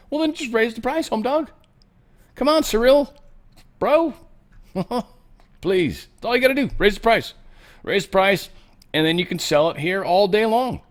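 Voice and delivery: high-pitched, exasperated coaxing; mock pleading. Host urging Cyril to just raise the price, in high mock pleading. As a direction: plead up high like it's so obvious.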